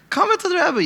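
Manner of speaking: high-pitched coaxing, falling off; urging. Retold or imitated. Acted urging to come in.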